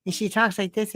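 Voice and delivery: ordinary talk, nothing marked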